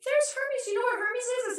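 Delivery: put-on very high, singsong toddler voice; imitating little niece. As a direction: squeak it like a tiny child. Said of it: "and she goes".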